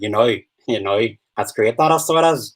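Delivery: Northern Irish accent with a corrected vowel in "know". Shown as a demonstration. Fixing the missed word for viewers, Northern Irish accent. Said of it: "I got the no wrong that".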